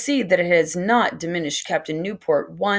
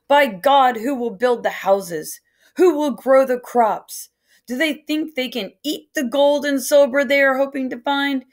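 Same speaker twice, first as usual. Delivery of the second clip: loud, higher angry ranting; angry shout; 6 semitones higher, 3 dB louder than usual. A narrator's reading. Captain ranting to Reverend Hunt, angry loud shout. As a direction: rail against the useless gentlemen.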